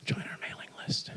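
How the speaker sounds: breathy whispered invitation; creepy whisper. Pretend. Speaker mocks sign-up forms, creepy whisper. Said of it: "A little creepy".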